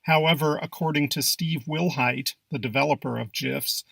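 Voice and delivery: ordinary talk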